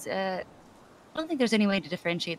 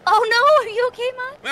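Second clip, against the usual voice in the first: high, loud worried cry; alarmed shout; 11 dB louder than usual. Real, not acted. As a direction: call out in alarm. Friend falls, alarmed.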